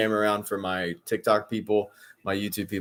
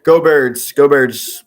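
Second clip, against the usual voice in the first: loud, higher rallying call; punchy fan chant, voice raised; team cheer; 5 semitones higher, 13 dB louder, much slower than usual. Real, not acted. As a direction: call out support for your team.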